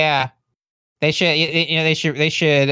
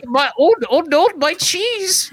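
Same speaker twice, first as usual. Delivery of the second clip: high, panicked Swedish sing-song; wailing over his cheese, Swedish accent; 11 semitones higher than usual. Pretend. Continuing the Swedish Jack bit.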